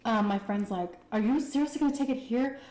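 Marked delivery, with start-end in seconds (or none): 1.1-2.6 higher, louder amazed question; can't believe what she's doing; incredulous friend. Retold or imitated. Friend amazed she'll take the test in the store, higher and louder.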